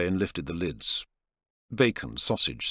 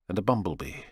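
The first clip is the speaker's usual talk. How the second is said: plain reading voice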